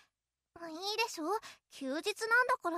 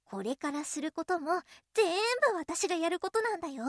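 ordinary talk, nothing marked

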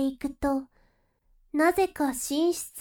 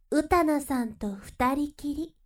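ordinary talk

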